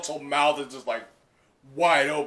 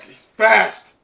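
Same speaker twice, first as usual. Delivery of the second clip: louder, higher alarmed line; mild mock alarm; alarmed sidekick; 8 semitones higher, 10 dB louder than usual. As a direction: blurt it in alarm. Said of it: "the sidekicks are like".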